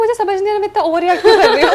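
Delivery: high, loud, laughing mock yell; retold overreacting yell. To Charlie, confused at her own drama, loud laughing yell. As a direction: replay the over-the-top outburst. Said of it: "और मैं चिल्ला रही".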